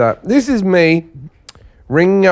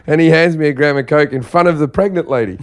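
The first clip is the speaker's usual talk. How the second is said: ordinary talk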